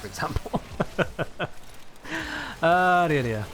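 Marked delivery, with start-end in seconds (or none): 0.7-2.6 high, soft chuckling; helpless laugh at a fond memory; real giggling laugh. Real, not acted. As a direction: dissolve into quiet, high giggles. Laughs recalling D&D spell attempts.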